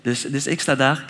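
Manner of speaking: ordinary delivery, nothing marked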